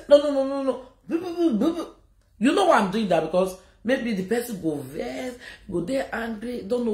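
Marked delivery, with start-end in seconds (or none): 0.1-1.9 high, teasing no-no and boo chant; mock booing. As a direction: jeer at someone to humiliate them. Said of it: "I will come out and say".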